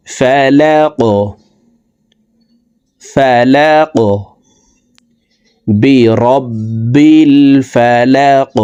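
ফালাক ending with a bouncing Q; qalqala on qaf. Shown as a demonstration. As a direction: push the final ক up with a jolt. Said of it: "এইভাবে পড়ব". (কলকলা দিয়ে ফালাক)

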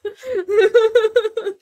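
loud, high burst of laughter; helpless high laugh; real laughing fit. Real, not acted. Laughing after cat screamed.

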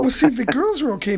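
ordinary talk, nothing marked

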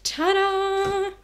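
high, drawn-out sung ta-da; sing-song reveal. Real, not acted. Girl revealing the fridge to viewers on a tour, sing-song reveal.

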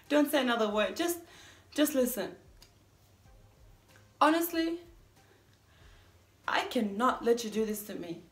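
self-confessed mean woman, exasperated. Acted. Confessing to a partner about being disliked, exasperated and emphatic. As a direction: insist they hear you out, with deliberate pauses. Performs a monologue character.

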